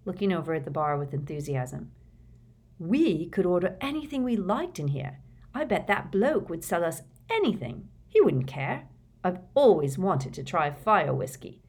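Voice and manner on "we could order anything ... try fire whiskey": lightly voiced eager teenage boy; a hint of eager young Ron. A narrator's reading. Ron's voice in audiobook.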